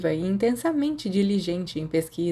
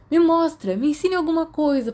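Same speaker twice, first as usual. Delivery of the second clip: excited pleading; 10 semitones higher, 4 dB louder than usual. Acted. Sirius pleads laughing.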